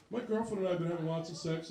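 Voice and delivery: low, formal son voice; stiff nerdy son. Retold or imitated. Son calling his dad, low and overly proper.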